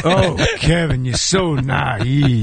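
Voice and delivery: slow, heavy Pacino rasp with drawn-out words; later Al Pacino. Retold or imitated. Teasing the host Kevin as Pacino, slow and heavy. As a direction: lean into late-career Pacino, dragging every word. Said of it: "I start talking like this".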